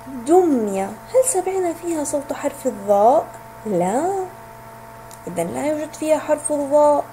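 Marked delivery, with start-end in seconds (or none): none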